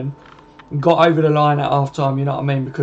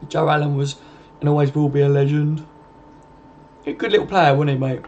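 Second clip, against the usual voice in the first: ordinary talk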